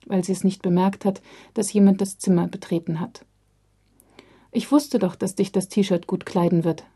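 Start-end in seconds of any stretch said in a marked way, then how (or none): none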